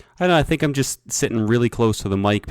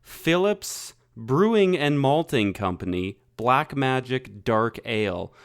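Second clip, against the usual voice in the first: ad read; 6 semitones higher, slower than usual. Acted. Sponsor-style beer review read.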